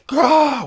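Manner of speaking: high, loud startled yell; imitated scream.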